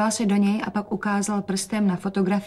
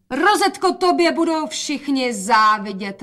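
higher, louder, slow admiring call; 6 semitones higher, 4 dB louder than usual. A narrator's reading. Mother calls out admiring her daughter, higher and louder. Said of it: "volala matka".

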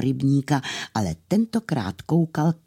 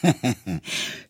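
short acted chuckle; miller's chuckle. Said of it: "zasmál se mlynář".

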